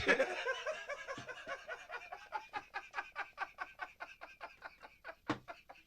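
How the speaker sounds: helpless wheezing laughter, high and breathless; can't stop laughing at the story; long high wheezing laugh. Real, not acted. Cracking up at Tyson quote.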